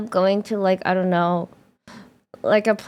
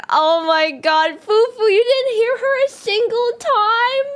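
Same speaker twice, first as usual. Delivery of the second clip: laughing disbelief; 12 semitones higher, 3 dB louder than usual. Retold or imitated. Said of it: "And then I was like".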